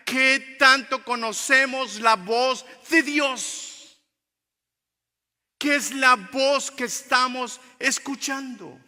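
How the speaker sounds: loud, high, emphatic sermon cry; full-force preaching. Real, not acted. Preacher challenging the church to know God's voice, at full force. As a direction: thunder each phrase at the congregation.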